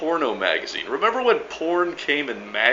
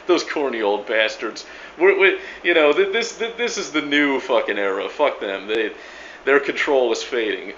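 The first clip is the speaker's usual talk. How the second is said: mocking scoffs with sarcastic ha-ha laughs; snarky smart ass. Coffee shop smart ass dismissing the Pentagon, snarky mockery. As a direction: sneer dismissively like a coffee-shop know-it-all. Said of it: "laughing like a snarky coffee shop smart ass".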